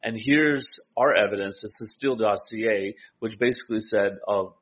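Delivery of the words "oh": normal talking voice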